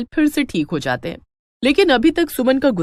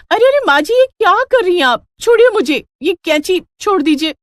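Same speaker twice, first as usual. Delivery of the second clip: much higher, louder panicked begging; frightened plea; 9 semitones higher, 5 dB louder than usual. Acted. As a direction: beg her to drop the scissors, panicking. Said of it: "प्रीति भी डर जाती है". (डरी हुई बहू की ऊँची, तेज़ घबराई गुहार)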